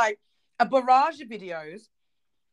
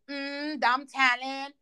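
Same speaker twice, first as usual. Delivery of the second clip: mock playground kid; 3 semitones higher, much slower than usual. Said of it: "a little six-year-old in the playground going".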